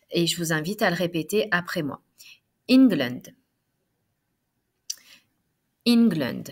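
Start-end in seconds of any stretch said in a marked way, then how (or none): 2.7-6.4 "England" said with an opening "in" sound, as if spelt I N; E prononcé comme I. Shown as a demonstration. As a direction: model "England" clearly, starting with "in" rather than "en". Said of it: "ça se prononce comme si c'était I N". (« England » prononcé avec « in » au début, comme s'il s'écrivait I N)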